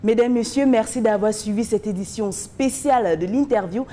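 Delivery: announcer sign-off. Acted. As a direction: close the show like a TV host addressing viewers.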